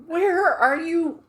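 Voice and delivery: ordinary delivery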